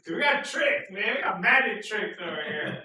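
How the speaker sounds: high, loud showman calling out; a loud, high carnival barker energy; loud excited hype. Real, not acted. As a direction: hype up the magic tricks. Hyping the camera, laughing.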